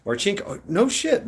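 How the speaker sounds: usual speaking voice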